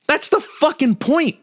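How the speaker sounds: high, loud, slowed emphasis; heated emphatic outburst. Real, not acted. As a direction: hammer the point home, irritated. Ranting about data and apps.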